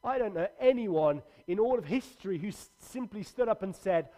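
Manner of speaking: ordinary talk, nothing marked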